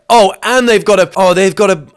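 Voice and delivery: loud, high and drawn-out; mock outburst. Host piling on an absurd new punishment, mock outburst. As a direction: blurt it out with hammed-up force.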